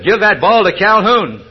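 the coach losing patience, drawing the order out; upset coach yelling. Retold or imitated. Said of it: "the coach got a little more upset".

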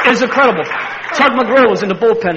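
high, loud, proud; laughing triumph; thrilled. Real, not acted. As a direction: savour the glory. Laughing pride in ovation.

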